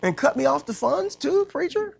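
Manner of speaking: mock childish whine. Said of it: "I said".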